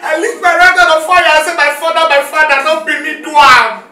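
loud, desperate swearing; pleading loud oath. Acted. Niece swearing before uncle she is innocent, loud plea. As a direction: cry out to convince uncle.

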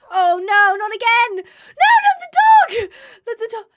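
loud, high shout of alarm; panicked shout as her dog is harmed; panicked cry. Real, not acted. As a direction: scream as your dog gets hurt. Dog under attack, panic.

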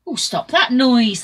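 loud, irritated crowd shout; shouting back. A narrator's reading. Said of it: "the people shouted back".

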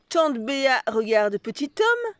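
ordinary delivery

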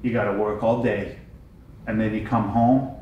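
low, hushed deadpan with pauses; soft, grave scolding, dropping to near whisper; imitated child's lecture. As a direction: quietly lecture dad as his little son. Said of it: "Getting lectured".

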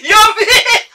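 very high, very loud panicked shouting; terrified shriek. Retold or imitated. Driver hearing a voice from the empty back seat, shrieking in terror. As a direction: scream in sheer fright at the voice behind. Hears ghost voice behind.

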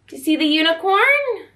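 plain reading voice

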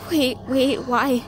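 ordinary talk

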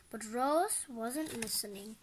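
usual speaking voice

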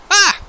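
loud, high yelp of fright; startled scream. Pretend. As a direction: yelp as if someone made you jump. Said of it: "you scared me".

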